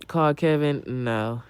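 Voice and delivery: ordinary talk, nothing marked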